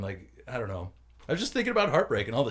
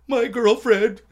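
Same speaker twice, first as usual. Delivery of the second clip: laughing; 9 semitones higher, 9 dB louder, slower than usual. Retold or imitated. Retelling pitiful heartbreak confession.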